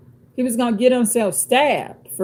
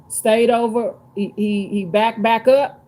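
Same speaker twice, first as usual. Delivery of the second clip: ordinary talk, nothing marked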